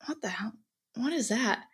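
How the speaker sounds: puzzled question under the breath; retold hushed aside. Student asking the friend sitting next, hushed retold aside.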